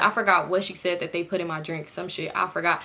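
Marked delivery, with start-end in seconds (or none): none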